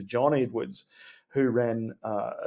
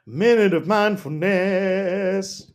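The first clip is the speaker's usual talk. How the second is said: mock dramatic echo; 7 semitones higher, 7 dB louder, much slower than usual. Echoing the mock voice.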